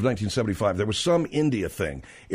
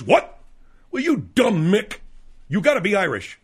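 big bar guy; 7 semitones higher than usual. Retold or imitated. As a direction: sneer it like a burly drunk. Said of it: "he goes".

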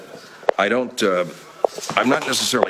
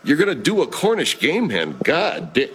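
Ted Bell; 3 semitones higher than usual. Acted. Owner repeats the chef's dish back, exasperated. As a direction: echo it back in pitched-up disbelief. Boss echoes chef in exasperation.

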